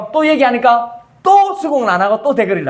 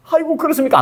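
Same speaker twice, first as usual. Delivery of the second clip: higher mock-surprised sneer; mock reply; 8 semitones higher than usual. Peter scoffs at Jesus, mock surprise. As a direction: scoff in a high put-on voice.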